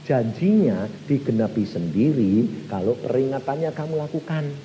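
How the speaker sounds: ordinary talk, nothing marked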